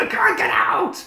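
high strained mock yell; panicked shout. Hero trapped under the manhole, panicked yell.

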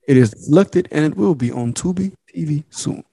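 steady, deliberate sponsor read. Acted. Host reading the podcast sponsor, announcer style.

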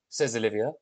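usual speaking voice

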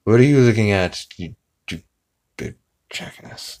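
mock sleepy grumble fading out. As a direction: start loud, then sink to an unvoiced murmur. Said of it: "he's so sleepy".

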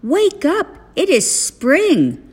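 high, excited wake-up call; loud and pitched well up; shouting. A narrator's reading. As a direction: shout to rouse a sleeping friend. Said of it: "shouted Frog".

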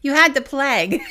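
laughing loudly. Real, not acted. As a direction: laugh through the words. Laughing about being sick.

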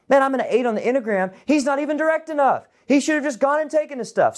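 high, fast, pushy voice of a keen viewer; mock eager commenter. Viewer in the comments urging David on, high and fast. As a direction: play a bold commenter itching to take action.

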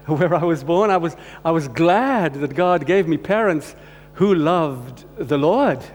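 ordinary delivery

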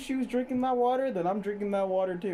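high swaggering bro talk. Pretend. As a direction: hype it up like a frat bro. Bro voice, cheering on.

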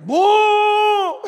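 loud, drawn-out mock tuba honk "Boo"; terrible tuba blast. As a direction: blare "Boo" like a kid's bad tuba. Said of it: "but it sounded terrible".

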